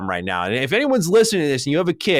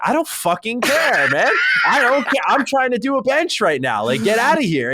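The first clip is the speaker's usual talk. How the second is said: higher-pitched, exasperated retold complaint; acted exasperation; 8 semitones higher than usual. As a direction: act out fed-up impatience at a gym interrupter. Retelling an annoyed gym reply.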